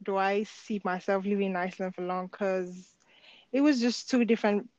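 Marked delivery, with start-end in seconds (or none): none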